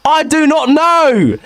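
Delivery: high-pitched comic exasperation, stretched out at the end; exasperated rant. Real, not acted. Podcaster ranting about the caller's bush quiz, high and exasperated.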